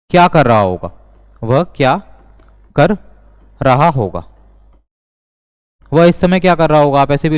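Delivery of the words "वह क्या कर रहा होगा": slow dictation with long pauses; spaced out, pausing after each chunk; word by word. Shown as a demonstration. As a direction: break the question into separate words for learners. Breaks the example up for learners.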